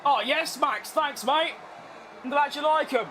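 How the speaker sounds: ordinary talk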